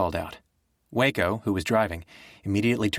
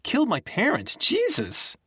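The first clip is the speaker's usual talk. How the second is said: much higher, tearful furious outburst; furious and crying. A narrator's reading. Girl blasting Nick for not understanding, furious and tearful. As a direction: throw the words at him through tears. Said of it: "she was also furious".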